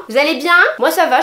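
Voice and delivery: ordinary talk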